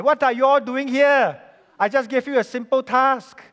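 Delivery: loud, high-pitched scolding; exasperated boss. Retold or imitated. Boss catching engineers still discussing a simple task, exasperated.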